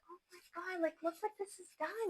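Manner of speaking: high, soft amazed gasp of delight; awed excited hush. Retold or imitated. Telling viewers about the kirtan list, high hushed awe. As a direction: whisper in wonder at what India did.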